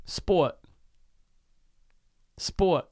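Sport with the T tapped behind the front teeth; a New York Sport; New York accent try. Shown as a demonstration. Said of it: "Give me a word".

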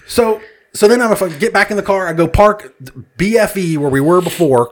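usual speaking voice

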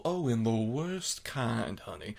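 slow, drawn-out mock Southern accent. Villain answering the girl, slow mock Southern accent. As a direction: lean into a slow Southern accent, savouring honey. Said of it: "just to even speak to your accent thing".

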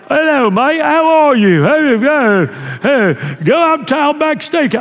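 high, hammed-up Australian greeting; Australian accent. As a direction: play a cheery Aussie saying hello, mate. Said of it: "They're like".

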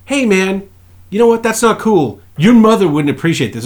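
high, mildly scolding referee; tame scolding ref. Retold or imitated. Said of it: "he's like".

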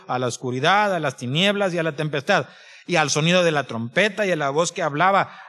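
brisk, continuous Bible read-aloud; rapid scripture reading. Real, not acted. Preacher reading Hebrews quickly to the congregation, rapid scripture reading. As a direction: read the passage straight through with little pausing. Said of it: "lo voy a leer bien rápido".

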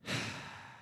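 imitating a long, breathy, exasperated sigh; dad's angry sigh. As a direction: let out dad's fed-up sigh. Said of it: "He does this thing where he sighs".

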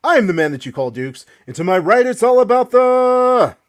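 booming ring announcer intro; grand entrance announcement. Acted. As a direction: hype up the crowd like a boxing announcer. Hosts introduce the show.

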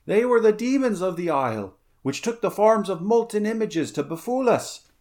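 high, urgent account of horror; alarmed corsair. A narrator's reading. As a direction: plead your case as a shaken survivor. Second corsair recounts the demon attack.

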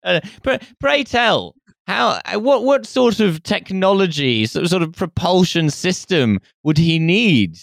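put-on high, slow pompous old gent; hammy stage actor. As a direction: ham it up like a stuffy Victorian scholar. Answers in mock archaic voice.

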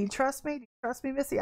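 God gently asks Missy; soft voice of God. Retold or imitated. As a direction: hush it like a quiet question. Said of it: "God keeps telling me".

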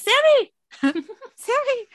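high, loud shout through a door; trapped man yelling up through a door. Retold or imitated. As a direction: pound and holler for your brother. Banging on trap door, calling.

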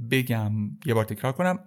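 usual speaking voice

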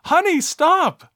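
high-pitched, drawn-out woman's cry; mock female voice, stretched and urgent; pleading lady. Lady running over to stop Guile, high and pleading. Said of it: "a lady runs over and we read".

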